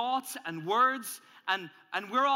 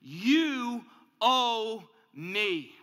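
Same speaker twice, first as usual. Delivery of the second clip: slow, loud menacing demand; anger itself demanding payment, slow and loud; 3 semitones higher, 7 dB louder, much slower than usual. Acted. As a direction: stretch it out like anger's claim. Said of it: "Anger will say one thing to you".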